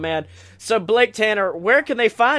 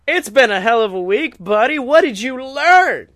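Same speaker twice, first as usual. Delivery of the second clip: ordinary talk, nothing marked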